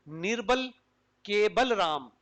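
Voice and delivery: निर्बल, clear pause, then के Balram run together; के joined to Balram as one name, falling; space after निर्बल. Shown as a demonstration. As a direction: break the phrase after निर्बल. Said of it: "space देने पर और इसको".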